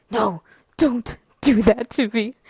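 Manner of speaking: loud, drawn-out protest; mock pleading. Begging Misha not to tell Rob, loud mock plea. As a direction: plead loudly, stretching each word.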